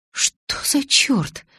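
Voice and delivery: drawn-out, half-whispered disbelief; halting, whispery gasp of dismay; stammering shock. A narrator's reading. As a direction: squeeze the words out slowly, stunned. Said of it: "выдавила я, заикаясь".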